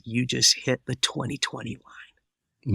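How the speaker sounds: quietly moved, letting the news land; a little emotional. Retold or imitated. Doctor telling his patient the news, softly moved. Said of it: "he got a little emotional and he said".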